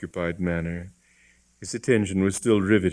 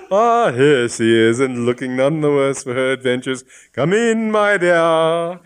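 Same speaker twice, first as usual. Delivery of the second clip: loud, much higher cheerful welcome; hearty greeting; 6 semitones higher, 8 dB louder than usual. Acted. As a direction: beam as she walks in. Bishop greets wife warmly.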